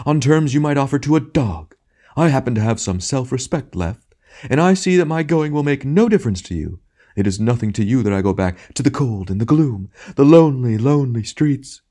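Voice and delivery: high, hammed-up wounded dignity; self-pitying tragedian. A narrator's reading. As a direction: ham actor milking his lonely exit.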